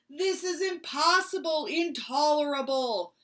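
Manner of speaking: feeble loud outburst; weak roar. Acted. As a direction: try to roar but lack strength. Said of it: "Bear started to roar, but he was too weak".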